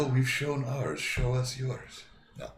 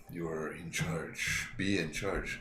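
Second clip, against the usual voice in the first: ordinary talk, nothing marked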